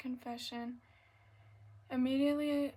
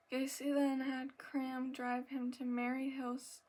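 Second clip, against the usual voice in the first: ordinary talk, nothing marked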